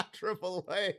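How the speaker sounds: laughing through the words; helpless laughter. Real, not acted. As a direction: crack up around the name. Still laughing at the message.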